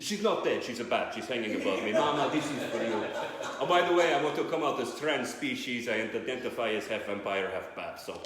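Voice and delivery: low, hammed-up vampire accent; Dracula. Vampire thanking agents and Mama for an award, low accented voice. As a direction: give an acceptance speech as a comic vampire. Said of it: "did Dracula win an Oscar".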